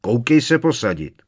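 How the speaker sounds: loud, high-pitched order; angry uncle ordering someone about; thundering. A narrator's reading. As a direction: thunder the command. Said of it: "zahřměl strýc Vernon".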